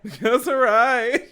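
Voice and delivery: high, loud, drawn-out holler; unnecessary yelling. Acted. Friend shouting demands from close by, unnecessary yelling. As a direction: play up a needless shout. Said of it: "[name]'s yelling unnecessarily".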